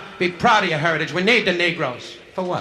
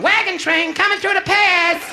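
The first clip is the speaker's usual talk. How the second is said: much higher, louder and slow; mock announcer cry; 4 dB louder, slower than usual. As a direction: call it out like a frontier crier. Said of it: "she was going".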